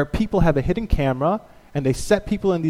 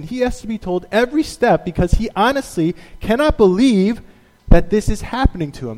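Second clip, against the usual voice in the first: usual speaking voice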